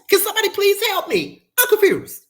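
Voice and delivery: high, pleading squeal; mock plea. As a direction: beg for help in jest. Exasperated commentary.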